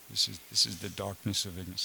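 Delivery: low, soft imitated aside; under his breath. Teacher retelling Prabhupada's aside during class, under his breath. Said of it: "under his breath, he said".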